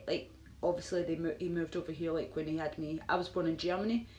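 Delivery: usual speaking voice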